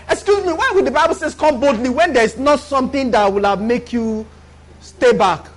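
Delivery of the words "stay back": usual speaking voice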